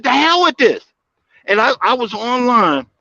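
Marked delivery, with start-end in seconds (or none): 0.0-0.8 fed-up outburst. Retold or imitated. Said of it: "I said".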